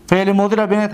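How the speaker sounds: ordinary delivery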